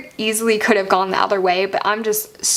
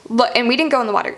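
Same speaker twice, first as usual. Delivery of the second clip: ordinary talk, nothing marked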